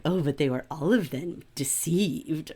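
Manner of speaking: low, soft mystical quote; lightly voiced hushed elf queen; a hint of an ominous prophecy; Galadriel.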